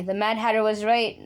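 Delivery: usual speaking voice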